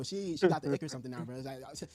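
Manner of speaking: usual speaking voice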